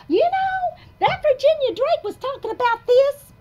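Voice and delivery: high, loud put-on voice of someone praising; mock admiring voice. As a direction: squeal it out like an excited fan. Retold praise from somebody.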